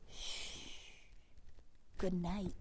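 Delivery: hushed goodnight. Acted. Hushing at story end.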